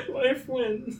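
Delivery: small mock battle cry; playful echo. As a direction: echo it lightly, up high. Co-host echoes the catchphrase.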